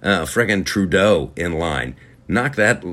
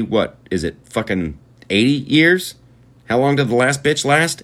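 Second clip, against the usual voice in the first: normal talking voice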